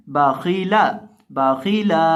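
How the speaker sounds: बाखीला with light ba and la, heavy kha; correct Arabic reading. Shown as a demonstration. Teacher modelling the right reading of बाखीला. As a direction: keep बाखीला short, kha thick. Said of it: "तो क्या पढ़ेंगे?".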